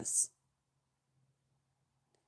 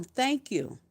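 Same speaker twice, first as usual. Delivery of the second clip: hushed, much softer thank-you; soft prayerful thanks; 7 dB quieter than usual. Real, not acted. Thanking Jesus for her freedom, hushed and prayerful. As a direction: breathe it out to Jesus.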